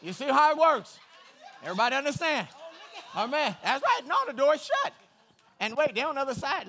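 loud fired-up preaching, pushing every line; preaching at full force. Acted. Pastor fires up the church, preaching loud. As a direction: preach it hard to the congregation.

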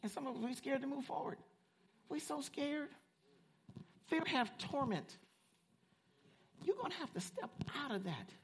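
high-pitched, pleading preacher; earnest preaching. Real, not acted. Preacher urging scared church to move forward, earnest high preaching. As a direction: keep the pitch up high but ease off the volume.

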